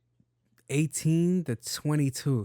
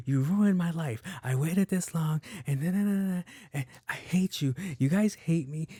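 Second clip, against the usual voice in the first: higher, lightly voiced whiny teen; mock teenager tantrum; 5 semitones higher than usual. Teenager blaming parents over cookies, mild mock whine. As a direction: throw a mild teen fit. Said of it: "the teenagers are like".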